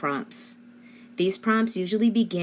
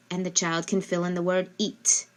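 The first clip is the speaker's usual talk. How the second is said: usual speaking voice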